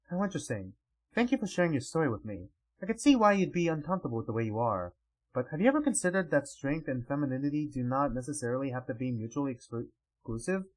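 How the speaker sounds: soft, measured formal character voice; lightly voiced courteous, thoughtful noble; composed ruler. King answering Natini's story, composed and softly spoken.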